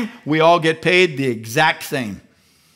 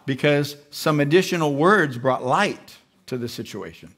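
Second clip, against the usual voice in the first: ordinary talk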